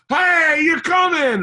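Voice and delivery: very high, loud mock cheer; surprise shout. Friends springing the hidden Burton on Gary, high surprise shout. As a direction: whoop it out as a gleeful surprise.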